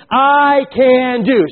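I can do, drawn out slowly with the congregation; slow emphatic repeat. Shown as a demonstration. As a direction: lead the room in "I can do", slowly. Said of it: "Say that with me".

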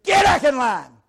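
high barked order; drill sergeant shout. Retold or imitated. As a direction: bark the order. Drill sergeant voiced in story.

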